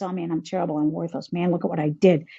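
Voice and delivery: low, muted mock despair; stuck in self-blame. Voicing people stuck feeling worthless, low self-blame. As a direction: sink into acted self-reproach. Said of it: "people get stuck in this".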